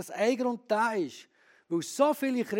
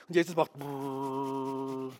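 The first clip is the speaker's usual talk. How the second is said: slow, low rattling brrrr; mock brrrr shake; 8 semitones lower, 7 dB quieter, much slower than usual. Preacher mimics Jesus shaking him, slow low brrrr. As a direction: rattle it off slowly like being shaken.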